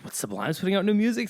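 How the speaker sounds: fast, high, startled question; blurting out in disbelief; surprised. Retold or imitated. Fan spotting a fake Sublime release, surprised. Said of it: "And I was like".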